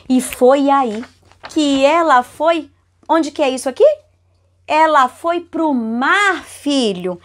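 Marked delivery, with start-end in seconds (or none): none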